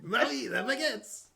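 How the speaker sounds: high, drawn-out mock French cry; hammed-up French accent, stretching the words. Player repeats the cut Disney line, exaggerated French accent. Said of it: "so stereotypical and offensive".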